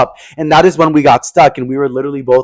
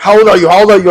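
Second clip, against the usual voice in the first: higher and louder demand, lightly done; pushy older guy; 5 semitones higher, 5 dB louder, faster than usual. Retold or imitated. Older hiker pressing Pablo for his age, louder. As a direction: bark out the question.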